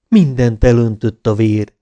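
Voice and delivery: usual speaking voice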